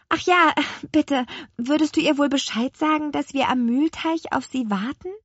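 put-on high-pitched little girl's request; childlike plea, pitched well up; pleading girl. A narrator's reading. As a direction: ask sweetly in a high child voice. Lea asks apple man a favour.

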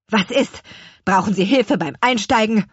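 put-on baker's father menacing his old boss; threatening. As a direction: offer help as a threat. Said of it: "fragte Herr Kuchenbrand drohend".

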